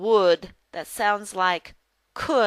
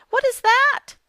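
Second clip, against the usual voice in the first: high, loud, surprised question; curious exclamation; 7 dB louder than usual. Acted. Lucy asks John as she comes out, high and curious. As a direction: ask it high and eagerly, as Lucy.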